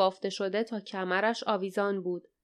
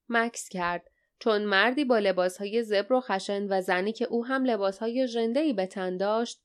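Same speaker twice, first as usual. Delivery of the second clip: ordinary delivery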